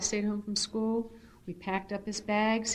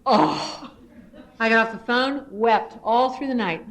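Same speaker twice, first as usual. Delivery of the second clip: normal talking voice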